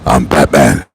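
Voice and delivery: deep, low Batman growl. Retold or imitated. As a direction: rumble it like the caped hero. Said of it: "funny when he said that on the movie".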